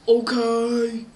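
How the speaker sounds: loud, drawn-out booming reply; gruff doorkeeper. Acted. Guy at the door gives in to the villain, booming voice. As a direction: boom out the consent. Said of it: "the guy".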